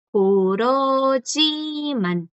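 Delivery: "Kurojiman" stretched very slowly, each vowel held; silent batchim demo. Shown as a demonstration. Teacher shows students the silent consonant rule, very slow pronunciation demo. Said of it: "prácticamente nunca suena".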